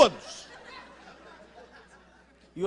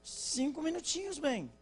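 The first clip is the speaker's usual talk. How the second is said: much higher, stretched "minutinhos" as she stalls again; wife repeating herself in a higher mock plea; wife again, more exaggerated; 10 semitones higher, 4 dB quieter than usual. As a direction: ham up the wife's second five minutes. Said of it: "Ela vai sair e fala com ele assim".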